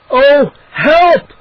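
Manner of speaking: high, loud scared cry for help; frightened cry. A narrator's reading. Boy crying out at the scorpion nest in fear. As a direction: cry out without meaning to. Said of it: "my tiny, frightened voice as I cried out".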